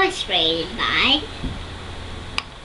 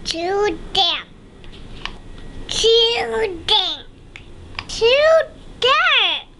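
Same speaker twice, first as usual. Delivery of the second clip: tiny high mouse voice climbing higher each time; squeaky mouse; 11 semitones higher, slower than usual. Acted. Mouse complaining about the damp cave, high squeaky voice. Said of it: "Mouse squeaks".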